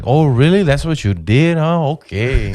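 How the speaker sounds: mock him with exaggerated disbelief; friends making fun. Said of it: "they started making fun of me".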